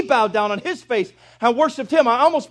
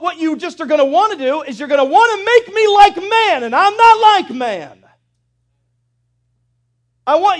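booming, higher, louder voice of God; God's voice; 6 semitones higher, 5 dB louder than usual. Retold or imitated. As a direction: speak as God laying down the law. Preacher voices God, rising.

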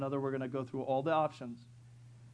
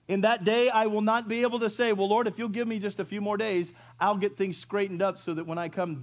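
louder preaching voice; a pastor preaching to the church; 6 semitones higher, 8 dB louder than usual. Acted. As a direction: press the point on the last day. Preaching about the last day.

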